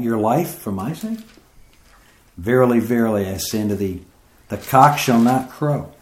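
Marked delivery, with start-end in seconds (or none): none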